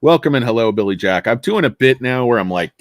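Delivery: ordinary delivery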